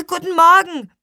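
put-on high, loud children's greeting; eager high-pitched hello; called out. A narrator's reading. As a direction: call it out brightly and loudly. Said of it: "riefen Lea und Luis".

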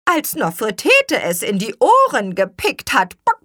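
high, loud chicken chatter; squawking hen, clucks dying away; clucking hen. Acted. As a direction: tell it excitedly, breaking into clucks.

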